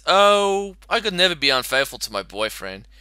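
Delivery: ordinary talk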